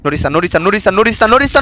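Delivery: ordinary talk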